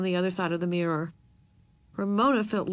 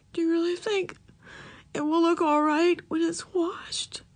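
tearful whisper; 9 semitones higher than usual. A narrator's reading. Tearful daughter asks hopefully.

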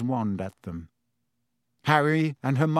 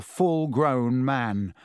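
plain reading voice

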